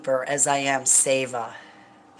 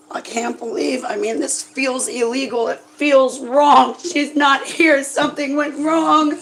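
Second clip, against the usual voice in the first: crying; 7 semitones higher, 4 dB louder than usual. Real, not acted. Grieving owner breaks down.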